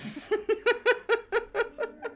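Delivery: high, rapid giggling; long real laugh in quick bursts; helpless giggle. Real, not acted. Streamer admitting a twelve euro splurge, giggling. Laughing at her own purchase.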